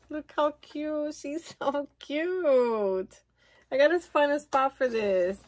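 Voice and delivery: ordinary talk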